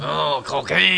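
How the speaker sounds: hammed-up French accent; mock French accent. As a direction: put on a showy French accent for the name. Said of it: "the correct French pronunciation".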